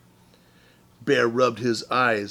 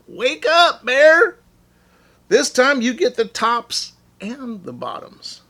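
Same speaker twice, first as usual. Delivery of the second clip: calling out; 12 semitones higher, 7 dB louder than usual. Acted. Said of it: "Hare called out".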